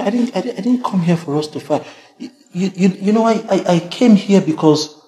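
high, loud stammering plea; panicked pleading. Acted. Dennis desperately pleading so Kemi won't leave. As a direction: stumble over words, desperate.

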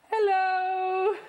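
loud, very high drawn-out greeting; piercing sing-out hello; high playful hello. Pretend. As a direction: squeal hello to a small child. Calling playfully to child.

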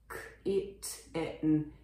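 kitten sounded out slowly, each sound distinct; emphatic phonics sounds. Shown as a demonstration. Teacher making the pronunciation thicker, emphatic phonics sounds. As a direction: stress every sound in k-i-t-e-n. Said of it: "発音をもうちょっと濃ゆめにすると".

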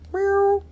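high mock meow; squeaky playful kitty meow; cat meow. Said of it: "Kitty cat in charoite".

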